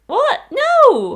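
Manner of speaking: high, loud shocked cry; outraged disbelief. Retold or imitated. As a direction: cry out in betrayal. Outraged at finale.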